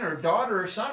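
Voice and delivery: ordinary talk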